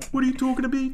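high-pitched catchphrase impression; high cartoon character mimicry; Sassy. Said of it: "wants to fucking do the Sassy voice".

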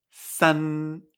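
san with a clear front n, mouth closing; Mandarin san. Shown as a demonstration. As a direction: say san with the tongue up behind the teeth. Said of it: "Esto se pronuncia san".

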